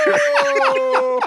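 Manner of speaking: high, loud yelp of disbelief; shocked no. Real, not acted. Hearing the pegging tweet read out, a high, loud yelp of shock. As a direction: shriek it in real shock.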